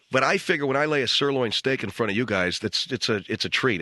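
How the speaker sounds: ordinary talk, nothing marked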